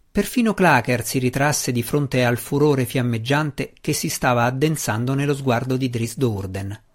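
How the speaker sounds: ordinary delivery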